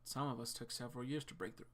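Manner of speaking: normal talking voice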